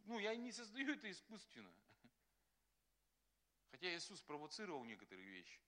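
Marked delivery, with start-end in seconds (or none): none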